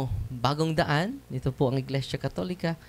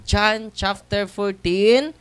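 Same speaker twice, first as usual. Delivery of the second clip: slow, high, loud proclaiming of the verse; scripture citation; 7 semitones higher, 6 dB louder, much slower than usual. Acted. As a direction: announce the verse like a preacher. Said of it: "citing scripture".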